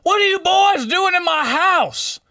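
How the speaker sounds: high, slow mock old-man voice; a startled homeowner demanding answers; angry old man. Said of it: "Some old man ... he's like".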